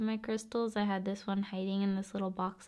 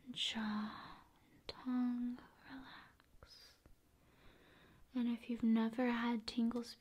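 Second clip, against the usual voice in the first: ASMR whisper; 6 dB quieter than usual. Acted. ASMR host guiding viewer through face, whispering. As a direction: whisper each body part softly.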